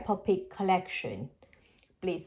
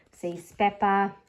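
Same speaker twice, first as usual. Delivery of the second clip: ordinary delivery, nothing marked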